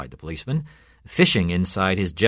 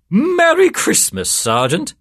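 high, loud, drawn-out jolly greeting; jolly laughing greeting; 7 dB louder, slower than usual. A narrator's reading. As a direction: hail the sergeant merrily, voice leaping up high. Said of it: "laughed Father Paul Peterson".

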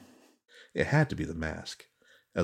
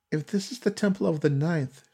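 put-on much higher, hushed young woman; Suki's uneasy voice; 11 semitones higher than usual. A narrator's reading. Frightened young woman naming the cursed temple, higher and uneasy. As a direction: speak it like dread rising in her throat. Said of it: "she uttered".

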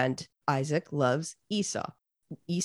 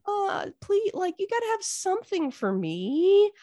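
high, drawn-out mock wail; hammed-up sad; slower than usual. Cheated son begging dad for a blessing, mock-sad wail. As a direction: plead in an exaggerated high whine. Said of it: "is so sad".

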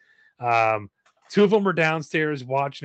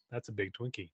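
soft quoted line; 4 semitones lower, 15 dB quieter than usual. Retold or imitated. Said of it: "threw something out there like".

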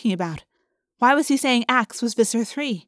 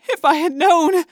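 high, loud pleading wail; terrified moan; 8 semitones higher, 7 dB louder than usual. A narrator's reading. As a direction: moan it in panic, pitched way up. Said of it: "the woman moaned".

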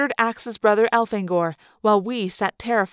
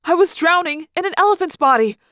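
mounting dread; 6 semitones higher, 5 dB louder than usual. A narrator's reading. Narrator drowning, rising panic.

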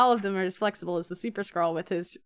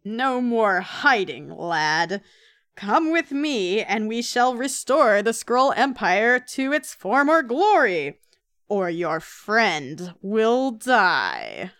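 loud, slow menacing villain voice; villain threat; 5 dB louder, slower than usual. Acted. Host voicing the Super Skrull's threat, loud and slow. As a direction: boom it out like a comic villain.

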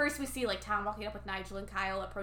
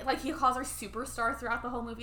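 usual speaking voice

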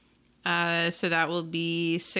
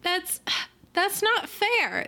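hurt protest; 12 semitones higher than usual. Acted. Falcon Girl hurt in argument.

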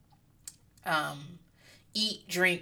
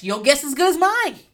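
ordinary talk

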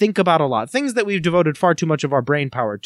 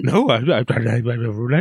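guttural noises; 4 semitones lower, slower than usual. Pretend. Host caught out by his sister, resorting to guttural noises. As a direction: stammer collapsing into guttural gibberish. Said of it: "I just resorted to guttural noises".

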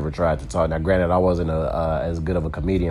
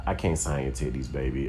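softer, a touch sheepish; soft mock refusal; 6 semitones lower, 8 dB quieter than usual. Comic refusing a fan's request, soft and sheepish. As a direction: tell her gently, baby. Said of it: "I'd be like".

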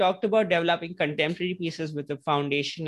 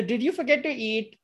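higher, mock teacher scold; imitating a teacher; 9 semitones higher than usual. Indian teacher chides a pupil who forgot a book, higher. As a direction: play the sarcastic schoolteacher. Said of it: "a common teacher thing".

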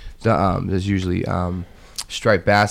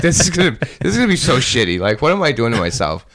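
mock panic; 3 semitones higher, 3 dB louder than usual. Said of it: "and I'm like".

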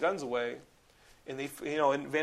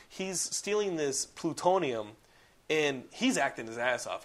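normal talking voice

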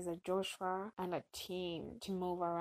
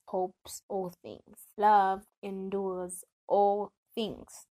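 slow, emphatic Bible recitation; deliberate declaiming, building to a loud close; scripture reading; 5 dB louder, slower than usual. Acted. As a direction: read the verse out with measured weight. Reads scripture aloud.

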